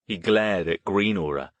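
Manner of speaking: ordinary delivery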